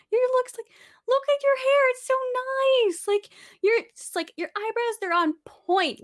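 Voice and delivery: high, gushing tipsy compliments; shower her with drunk praise; giddy party girl. Retold or imitated. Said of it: "drunk girls in the bathroom".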